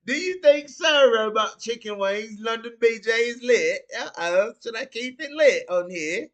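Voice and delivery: high, loud mock London accent; put-on London accent. Streamer asking chat if he should keep it lit, mock London accent.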